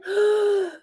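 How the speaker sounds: sharp high gasp before holding breath; demo deep gasp in. Shown as a demonstration. Nurse demonstrating pushing breath, a sharp gasp in. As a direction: suck a big breath in. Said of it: "take another breath in".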